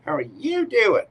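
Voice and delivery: hammed-up Joey-style "How are you doing?"; cheesy pickup line voice. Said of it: "You say it like Joey from Friends".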